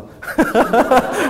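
high, loud burst of laughter. Real, not acted. Host laughing after praising brave volunteers, high laughter.

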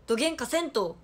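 loud, punchy Hakata dialect; forceful demo of heavy Hakata dialect; thick Hakata dialect. Shown as a demonstration. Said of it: "どぎつい博多弁喋って".

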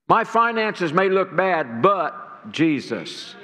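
slow, falling but Jesus, drawn out at the end; emphasis on Jesus. Shown as a demonstration. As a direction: drag out but Jesus with firm faith. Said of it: "You gotta turn that around and say".

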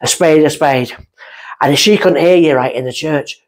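usual speaking voice

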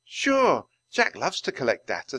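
bright high "Sure", then putting on cheer; a high, hopeful put-on cheer; forced cheer. A narrator's reading. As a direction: sound cheerful for her brother's sake. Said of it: "trying to be cheerful".

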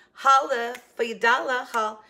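loud, high show-intro announcement; announcer jingle voice. Pretend. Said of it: "jingle for my shopping hauls".

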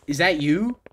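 ordinary talk

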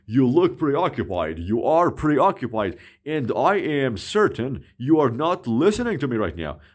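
ordinary talk

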